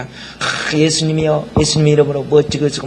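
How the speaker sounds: glib churchy patter, hurried and low; mocking pious talk. The pastor mocking hollow believers with slick pious patter. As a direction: rattle off pious words like a smooth talker. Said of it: "막 그래요".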